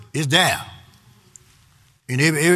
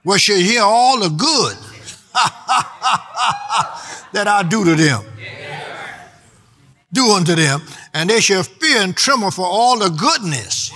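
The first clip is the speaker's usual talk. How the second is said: joyful scripture reading; 5 semitones higher than usual. Acted. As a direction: read it as good news you can't hold back. Reads scripture with laughter.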